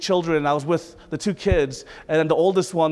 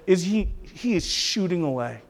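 usual speaking voice

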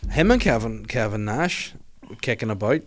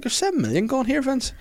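accountant voice; 14 semitones higher than usual. Retold or imitated. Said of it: "they're doing the books to be like".

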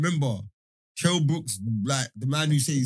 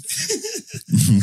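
loud squealing laugh bursting out; big high laugh; 6 dB louder than usual. Real, not acted. Laughing at the story punchline.

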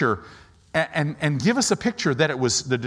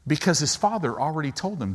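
ordinary talk, nothing marked

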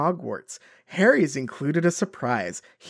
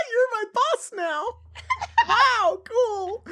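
much higher, laughing mock voice; mock starstruck Ron; slower than usual. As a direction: squeal it high like a giddy fan, laughing through it. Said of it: "Ron being like".

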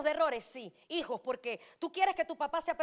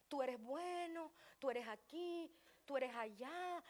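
mock soft flattery; 11 dB quieter, slower than usual. Mocking soft flattery of youth.

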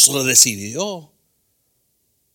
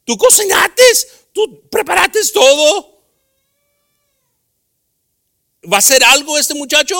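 higher and louder, fast exasperated fussing; Martha-style indignation; 9 dB louder than usual. Acted. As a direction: complain that the cook gets nothing back. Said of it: "pensar como Marta".

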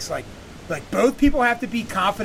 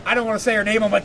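usual speaking voice

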